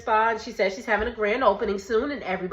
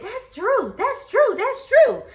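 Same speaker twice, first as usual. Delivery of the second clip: higher and louder with each that's Drew; insisting again and again; 13 semitones higher, 8 dB louder, slower than usual. Retold or imitated. Portia insisting to the group it's Drew, growing louder. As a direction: keep pushing it, climbing each time. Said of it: "she just keeps saying".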